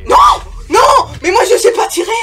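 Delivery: high, loud panicked yell; panicked shout. Real, not acted. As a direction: scream it in panic. Panics, can't shoot.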